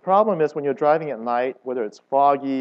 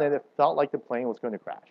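ordinary talk, nothing marked

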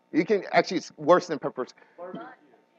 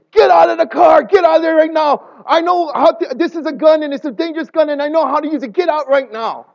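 shouting; 10 semitones higher, 8 dB louder than usual. Retold or imitated. Lady draws gun on strangers.

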